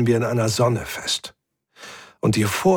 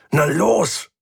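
higher, impatient push; angry urging through the effort; growled urging; 6 semitones higher than usual. A narrator's reading. Mechanic screwing at the shuttle drive, growled urging. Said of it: "knurrte er".

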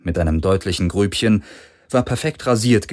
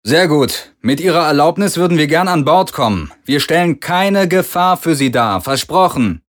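higher, louder man's voice, calm and persuasive; Parker's voice; 8 semitones higher, 5 dB louder than usual. A narrator's reading. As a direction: win them over, promise safety. Parker speaks over the speaker.

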